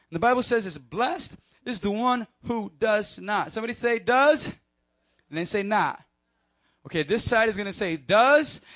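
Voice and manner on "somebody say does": ordinary talk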